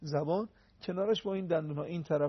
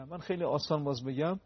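ordinary delivery

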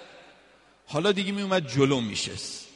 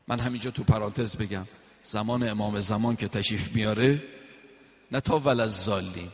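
ordinary talk

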